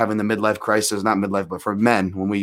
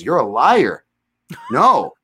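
flipping out; 3 semitones higher, 4 dB louder, much slower than usual. Retold or imitated. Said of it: "flips out and goes".

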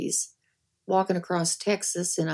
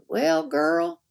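higher put-on voice of a rotund sheriff; higher mock sheriff voice; 9 semitones higher than usual. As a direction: play the sweaty small-town sheriff sizing her up. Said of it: "and he said".

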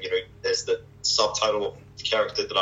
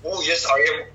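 higher-pitched attempt at Irish; Irish accent; 6 semitones higher than usual. Shown as a demonstration. Trying Irish for the interviewer, Irish accent. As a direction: try out a shaky-grasp Irish accent. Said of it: "Irish accent".

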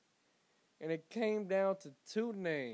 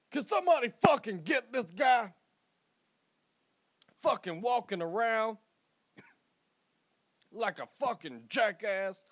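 mock outrage; 5 semitones higher, 7 dB louder than usual. Acted local complaining loudly.

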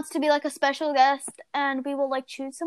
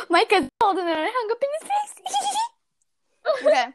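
giggling through the words; laughing while explaining; 8 semitones higher than usual. Real, not acted. Laughing after hanging up.

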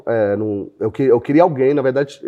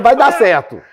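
higher and louder, a cocky retort; stubborn insistence; 10 semitones higher, 6 dB louder, slower than usual. Retold or imitated. Founder answering doubting investors, high loud insistence. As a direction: insist it will work, loudly. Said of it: "Eu falei".

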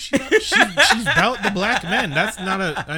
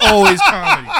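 laughing, loud and high; bursting out laughing; 12 semitones higher, 11 dB louder than usual. Real, not acted. Laughing about the show, loud and high. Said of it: "laughing through line".

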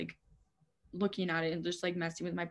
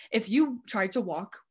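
normal talking voice

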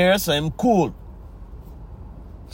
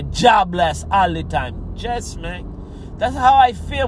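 ordinary talk

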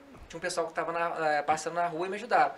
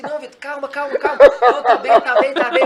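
raised, loud voice through laughter; laughing retelling; 6 semitones higher, 15 dB louder than usual. Retold or imitated. Narrator re-enacts reassuring his injured friend, laughing and loud. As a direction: act out frantic calming while laughing.

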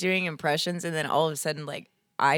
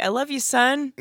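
mother's line; 7 semitones higher, 5 dB louder than usual. Pretend. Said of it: "what does a mother say?".

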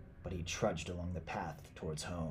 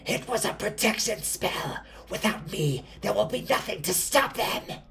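high, loud, desperate screech; hoarse screaming witch; 13 semitones higher, 6 dB louder than usual. A narrator's reading. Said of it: "Her hoarse voice could barely choke out the words".

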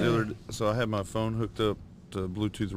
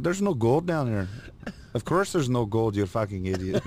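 Irish accent; 2 semitones higher than usual. Shown as a demonstration. Bickering over gold at rainbow's end, mock Irish accent. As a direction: argue as two mock Irishmen. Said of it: "Do Irish".

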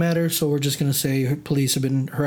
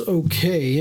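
normal talking voice

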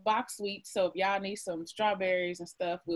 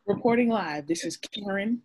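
newscaster voice; 3 semitones higher, 7 dB louder than usual. Pretend.

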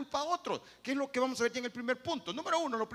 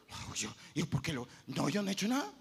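mock denial; 5 semitones lower, 3 dB quieter than usual. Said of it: "Escóndelo".

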